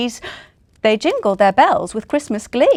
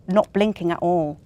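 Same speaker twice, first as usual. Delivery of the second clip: usual speaking voice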